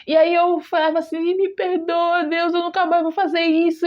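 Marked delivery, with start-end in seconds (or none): 1.2-3.9 higher-pitched pleading, a touch tearful; retold tearful plea. Dreamer promising God never to repeat it, retold tearful plea. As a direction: beg forgiveness, lightly imitating crying. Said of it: "E eu ficava chorando".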